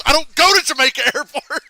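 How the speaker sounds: high, loud indignant yell; angry Bernie. Retold or imitated. Imitating Bernie goaded by Gavin, outraged shouting. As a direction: shout the denial in mock outrage. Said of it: "And Bernie goes".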